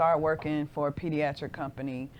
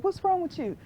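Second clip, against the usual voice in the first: much higher, startled mom's question; alarmed mother; 3 dB louder than usual. Retold or imitated. Mother bursting in on her screaming daughter, high alarmed question.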